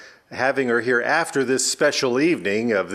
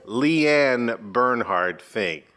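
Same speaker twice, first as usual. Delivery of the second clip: each name spelled out slowly and patiently; slow correction; 3 semitones lower, much slower than usual. Acted. Host slowly corrects the old teacher's name. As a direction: set the record straight, word by word.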